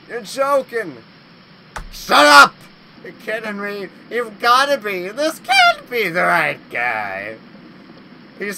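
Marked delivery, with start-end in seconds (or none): 1.9-2.5 high, loud exclamation; a sudden loud shut up; startled yell. Real, not acted. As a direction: blurt it out loud in disbelief. Yelling at the monster.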